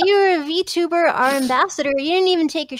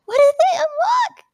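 excited; 13 semitones higher, 3 dB louder than usual. Real, not acted. Excited high question.

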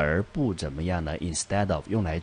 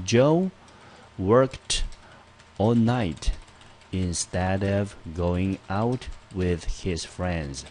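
slow phrase by phrase; much slower than usual. Shown as a demonstration. Teacher dictating the combined sentence to class, chunked and slow. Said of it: "写成这个样子".

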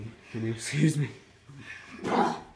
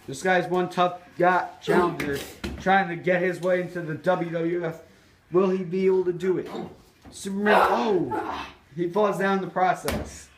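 lively ringside play-by-play; wrestling commentator; 5 semitones higher, 3 dB louder than usual. Acted. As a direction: call the match like a TV announcer.

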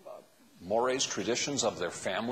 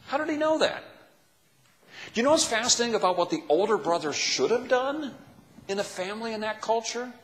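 usual speaking voice

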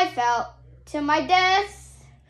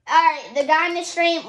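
ordinary talk, nothing marked

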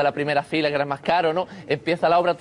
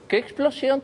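acting rehearsal; 9 semitones higher, slower than usual. De Niro rehearses line.

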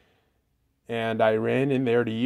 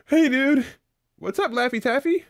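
very high, fast chatter, denying tears; a high cartoon kid voice, a touch teary; high squeaky character; 12 semitones higher, 4 dB louder than usual. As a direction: squeak it out, pretending nothing's wrong. Laffy Taffy character voice.

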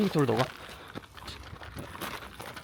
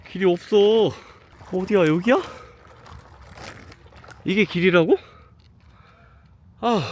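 disbelieving, raised voice; can't believe this is the road; exasperated; 5 semitones higher, 4 dB louder than usual. Real, not acted. Lost on a dark mountain path.

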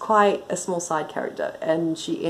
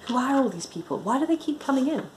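higher, exasperated demand; pitched up, testy questioning; sharp; 8 semitones higher than usual. A narrator's reading. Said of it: "she said sharply".

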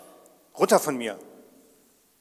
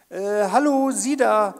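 calling out; 5 semitones higher, 10 dB louder, slower than usual. Acted. Calling out to distant strangers.

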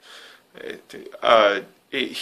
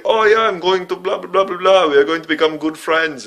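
higher, hammed-up German accent; 6 semitones higher, 4 dB louder than usual. Quoting the film's German guy befriending Django, in a hammed-up German accent. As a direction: play the friendly German guy in a thick German accent. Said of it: "all the Germans sound like the caterpillar from A Bug's Life".

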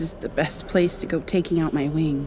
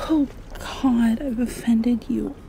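ordinary talk, nothing marked

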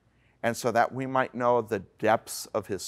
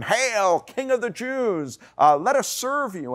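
high, loud mock-reverent hail; jeering shout of fake homage; 11 semitones higher, 4 dB louder than usual. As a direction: cry it out in mockery, pitched way up. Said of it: "in a mocking tone".

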